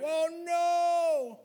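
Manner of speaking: high squealed alarm; mock dismay. A believer panics fifteen minutes after a blessing, high mock alarm. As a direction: yelp like sudden panic. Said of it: "you're like".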